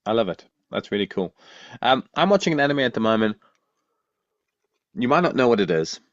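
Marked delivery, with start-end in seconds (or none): 1.8-6.0 talking around a lolly; words round a candy in the mouth; mouth full. Real, not acted. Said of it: "a lolly in my mouth".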